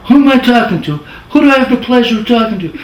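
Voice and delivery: high, loud crooner voice on the phone. Retold or imitated. [name] phoning his lone editor, high, loud crooner impression. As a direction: ham up the famous old singer calling in. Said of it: "That's how I talk, kinda".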